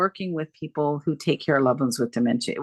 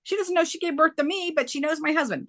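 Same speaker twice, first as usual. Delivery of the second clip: much higher, incredulous outrage; high-pitched stunned protest at the unfairness; indignant disbelief; 12 semitones higher than usual. Real, not acted. Telling the host about her mother, high indignant disbelief.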